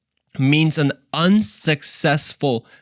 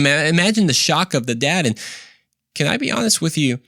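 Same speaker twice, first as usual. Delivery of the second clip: ordinary talk, nothing marked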